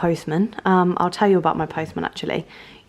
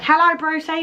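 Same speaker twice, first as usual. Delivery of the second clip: high, imitated call through a window; mock shouting through; 7 semitones higher than usual. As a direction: imitate the postman calling to the dog. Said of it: "like, shouting through".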